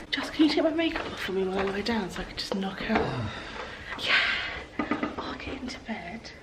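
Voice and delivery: sleepy whisper, barely voiced. Real, not acted. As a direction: whisper as if drifting off. Late night, lying down to sleep.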